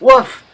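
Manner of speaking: loud high playful woof; mock dog woof. Real, not acted. Friend mocking the Bark Lord typo with a woof.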